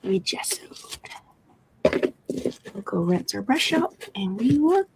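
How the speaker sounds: soft ASMR whisper; breathy hushed craft voice. Real, not acted. Painting session whisper.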